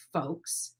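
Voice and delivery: ordinary talk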